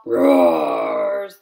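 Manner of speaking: loud, slow roar; loud, slow lion-cub roar. Acted. Cub roars suggestion.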